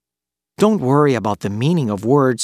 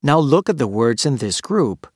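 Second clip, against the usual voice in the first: ordinary talk, nothing marked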